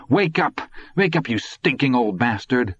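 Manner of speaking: high, urgent, angry cry; angry rousing. A narrator's reading. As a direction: shout him awake without full volume. Todd shakes the old man awake.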